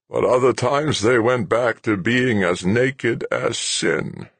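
put-on slow, high, wistful old man. A narrator's reading. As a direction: linger on the memory longingly. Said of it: "He almost sounded wistful".

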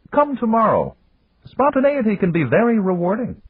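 high, measured executive voice; Japanese businessman voice. Acted. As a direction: voice him high and formal. Yamagata speaking in story.

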